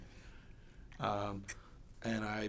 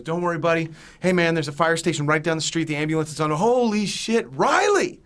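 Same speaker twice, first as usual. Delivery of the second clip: loud urgent reassurance building to a high yell; re-enacted panicked shouting; 5 semitones higher, 10 dB louder than usual. Friend reassuring crash victim trapped in car, imitated frantic shouting. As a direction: shout encouragement, then burst out in shock.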